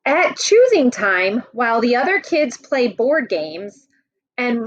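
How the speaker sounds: lively picture-book read-aloud; reading to children. Acted. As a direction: read it out to the class like a storybook. Teacher reads picture book to class.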